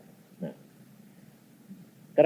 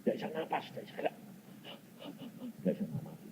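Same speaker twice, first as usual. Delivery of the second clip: breathless; 4 semitones higher, 15 dB quieter, much faster than usual. Retold or imitated. Woman can't breathe.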